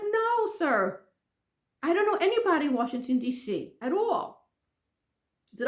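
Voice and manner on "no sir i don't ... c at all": re-enacted emphatic refusal; exasperated denial. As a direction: start high and firm, drag out the ending.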